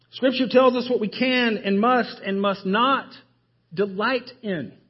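ordinary delivery

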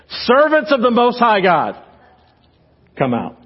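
commanding call ringing out. Retold or imitated. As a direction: boom "Servants of the Most High God" like a king at the furnace door. King calls them from furnace.

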